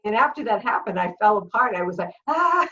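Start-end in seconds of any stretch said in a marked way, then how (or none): 2.3-2.7 high startled gasp, a bit louder; faint gasp. Retold or imitated. Recalling the robbers, a startled gasp. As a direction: let out a shocked little cry. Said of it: "I just almost passed out".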